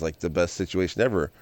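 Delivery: ordinary delivery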